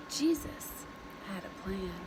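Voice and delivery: ordinary delivery, nothing marked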